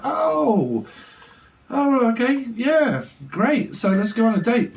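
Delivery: an oh-no reveal masked with cheer; surprised then forced enthusiasm. Retold or imitated.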